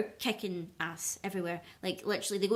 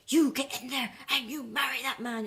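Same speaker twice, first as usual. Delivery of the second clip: mock mum scream; 5 semitones higher, 3 dB louder than usual. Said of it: "screams out".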